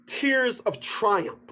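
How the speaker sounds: ordinary talk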